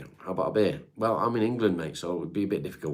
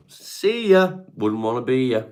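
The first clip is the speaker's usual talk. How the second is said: loud high taunt; taunting singsong; 6 semitones higher, 10 dB louder than usual. Pretend. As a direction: sing it out to a troll. Dismissing a chat troll.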